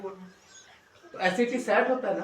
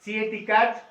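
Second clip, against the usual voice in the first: normal talking voice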